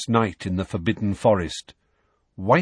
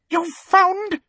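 much higher, shrill disbelieving query; shrill question; 4 dB louder than usual. Acted. Umbridge shocked that Dumbledore found a teacher, shrill. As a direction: squeak the words in disbelief. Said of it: "said Umbridge shrilly".

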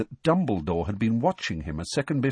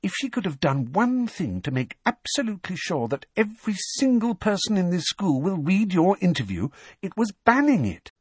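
high, gleeful explanation; pleased, high-voiced excitement; delighted; 10 semitones higher than usual. A narrator's reading. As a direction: build with glee to the final point. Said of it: "she beamed with pleasure".

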